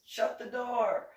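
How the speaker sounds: loud, drawn-out mock yell; acted holler, each word stretched; retold yell. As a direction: imitate an ex yelling a slow, loud order. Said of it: "my now ex-boyfriend yells".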